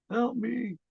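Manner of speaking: imitating a frightened girl; scared plea. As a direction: beg for your life. Voicing victim.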